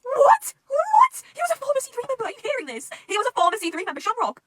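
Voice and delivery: loud, very high-pitched outburst; shocked disbelief. Real, not acted. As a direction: shriek it, stunned by a plot twist. Shocked by the book's twist.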